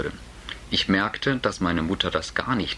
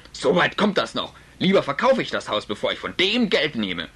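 Vater, etwas lauter und trotzig; zorniger Vater; 9 semitones higher, 3 dB louder than usual. Acted. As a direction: trotzig auftrumpfen. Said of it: "eine recht laute Unterhaltung". (Vater lehnt das Geld ab, trotzig)

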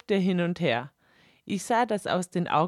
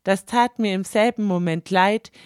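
usual speaking voice

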